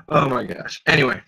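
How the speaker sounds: ordinary delivery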